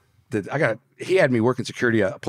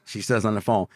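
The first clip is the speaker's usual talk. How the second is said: ordinary talk, nothing marked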